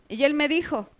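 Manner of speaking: ordinary talk, nothing marked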